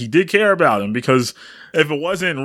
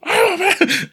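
higher, wailing "I abandoned"; mock anguished cry. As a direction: cry it out as if shaking. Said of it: "he's shaking".

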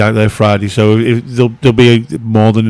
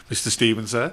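tag mock chauffeur; 12 dB quieter than usual. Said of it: "I'll turn up in a suit and go".

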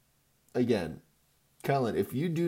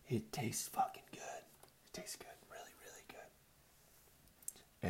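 savoring whisper; 16 dB quieter, slower than usual. Acted. Savoring coffee, secretive aside.

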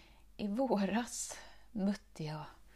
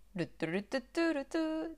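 usual speaking voice

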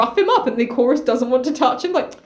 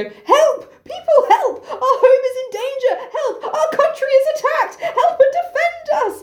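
very high, hammed-up alarm; panicked cry for help; 11 semitones higher than usual. Chorus calling citizens to defend them, high mock panic.

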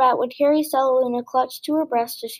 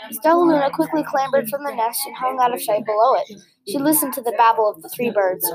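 ordinary delivery, nothing marked

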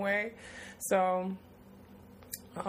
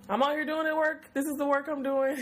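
higher, lifted pride in the work; fired up; 6 semitones higher than usual. Real, not acted. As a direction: pump yourself up about the work. Hyped about doing the work.

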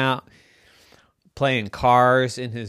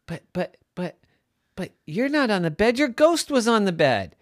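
stammering, then higher disbelief; flustered; 10 semitones higher, 3 dB louder, faster than usual. Acted. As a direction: act a baffled mom tripping over the first word. Bewildered Mommy contradicts Theo.

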